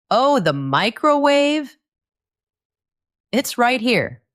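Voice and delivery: lifted, eager "oh, the microwave" voice; cheerfully help them out; bright helpful recognition. Acted. Helpful friend names the word.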